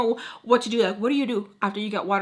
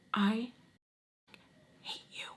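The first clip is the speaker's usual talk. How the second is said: whispered, drawn-out loathing; imitated whisper; 9 dB quieter, slower than usual. Rival staring at the nanny, hateful whisper. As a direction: whisper it with a long pause inside. Rival stares, hissed insult.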